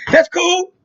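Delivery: high, breezy mock-casual voice; mock nonchalance. Cousin's parents' friend pretending not to care, high mock-casual. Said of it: "goddamn motherfucker's like".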